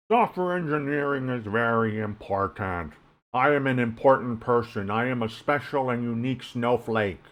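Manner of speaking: mocking internet know-it-all voice; neckbeard voice. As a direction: parody a self-important forum nerd. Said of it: "Did you guys like my neckbeard voice".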